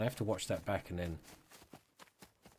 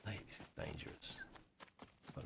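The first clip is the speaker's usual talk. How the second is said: whispering; 16 dB quieter than usual. Real, not acted. Tense moment alone in game.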